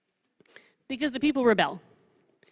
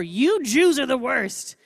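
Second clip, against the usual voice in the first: slow, louder, put-on voice of a scolding prophet; mock prophet scolding; 4 semitones higher, 7 dB louder, slower than usual. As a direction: drag it out like a fed-up prophet.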